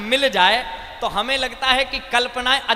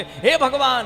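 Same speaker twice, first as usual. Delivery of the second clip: panicked cry; 4 semitones higher, 4 dB louder, slower than usual. Acted. Panicking rider keeps crying out.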